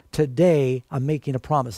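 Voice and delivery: "Today" punched and falling; stress on TODAY. Shown as a demonstration. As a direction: lean on the word "Today". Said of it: "the emphasis is on the word".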